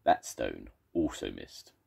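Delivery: plain reading voice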